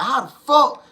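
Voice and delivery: high, loud, stunned "How the fuck?"; amazed invaders. Retold or imitated. Invaders seeing the beautiful land, a high stunned blurt. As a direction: blurt it out like you can't believe the view. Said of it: "They were like".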